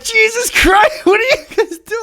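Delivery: high, squealed mock outrage; appalled disbelief. Grandson reacting to old relatives at a restaurant, high mock horror. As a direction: squeal it, scandalized by what you heard.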